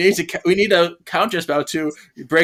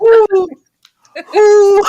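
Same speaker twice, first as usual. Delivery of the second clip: excited ooh; 8 dB louder than usual. Real, not acted. Mock-excited reaction.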